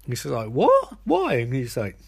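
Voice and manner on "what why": very high retold disbelief; two squeaked questions, imitating shock; mock shock. Sister hearing of the truck sabotage, mock shocked.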